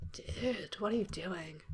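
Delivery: soft unvoiced whisper of disgust; hushed, appalled whisper. Real, not acted. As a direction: whisper it, grossed out. Disgusted at game character.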